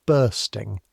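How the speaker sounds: ordinary delivery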